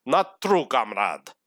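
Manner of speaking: hammed-up Russian accent, landing on comrade. Teasing viewers about the accent, in mock Russian accent. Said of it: "It's a Russian accent".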